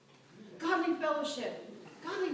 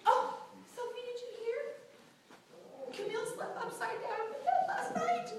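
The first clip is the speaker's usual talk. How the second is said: gossiping friend; 8 semitones higher, 5 dB quieter than usual. Retold or imitated. Church friend spreading gossip to Sophie, hushed and high. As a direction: lean in and dish the gossip. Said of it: "we use it to justify gossip".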